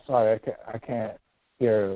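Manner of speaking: usual speaking voice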